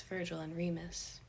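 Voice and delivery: ordinary delivery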